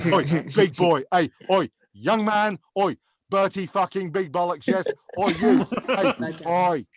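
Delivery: loud, barked mock-angry calls; Gordon Ramsay. Chef calling out a diner to praise him, mock-angry barking. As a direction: hector them in short repeated bursts. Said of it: "can sound angry and pissed off".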